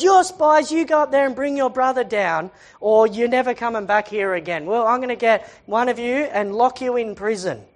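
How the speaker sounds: ordinary talk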